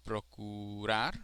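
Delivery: procurar slowly, with a stressed final RAR; stress on last syllable. Shown as a demonstration. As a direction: stretch procurar, landing on RAR. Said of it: "is the last".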